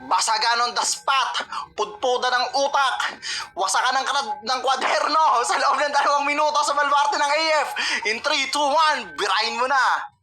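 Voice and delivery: big build-up into the countdown; hype announcer. Acted. Host announces second round.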